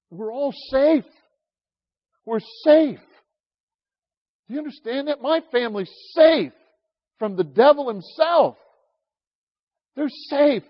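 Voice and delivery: loud, high, swelling emotion; overcome with joy. Real, not acted. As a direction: cry it out with joyful relief. Tearful joy over family's safety.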